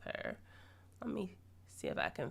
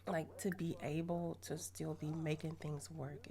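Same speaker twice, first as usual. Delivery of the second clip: ordinary talk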